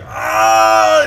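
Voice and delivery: sudden loud "Ah!" of outrage; loud indignant exclaim; shout. Retold or imitated. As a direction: bark out a startled angry yell. [name] shouts at the waiter.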